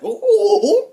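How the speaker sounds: high, loud excited squeal. Retold or imitated. High loud squeal at the sight of Harley. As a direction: squeal with delight like a giddy fan.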